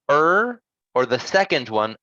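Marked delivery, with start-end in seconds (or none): 0.1-0.6 "er" held high on its own; isolated stressed vowel. Shown as a demonstration. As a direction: sound out a long, high "er". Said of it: "The first one".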